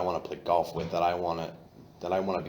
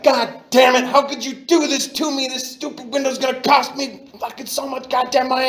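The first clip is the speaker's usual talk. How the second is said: furious high yelling at himself; flipping out; 9 dB louder than usual. Retold or imitated. Drunk man screaming at his own broken car window in fury. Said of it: "I flipped the fuck out".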